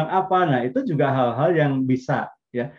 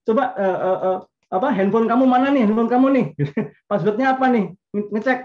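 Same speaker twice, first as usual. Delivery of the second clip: hammed-up jealous wife grabbing the phone; playful wife demanding the husband's phone; suspicious wife demanding; 7 semitones higher than usual.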